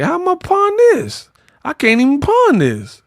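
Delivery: high-pitched mock disbelief. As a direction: squeal in hammed-up dismay at a worthless gift. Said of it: "[name] looked at it like".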